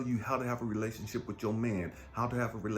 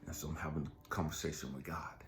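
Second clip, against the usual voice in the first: whisper; 7 semitones lower, 6 dB quieter than usual. Real, not acted. Whispered confession.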